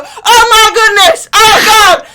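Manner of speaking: high, loud panicked shout; panicked cries. Acted. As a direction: scream in alarm as a friend burns. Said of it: "his friends go".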